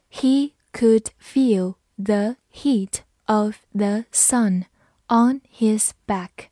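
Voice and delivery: slow, word-by-word model sentence. Shown as a demonstration. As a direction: read slowly with a small gap after each word. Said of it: "好，慢读一遍". (逐词慢读示范)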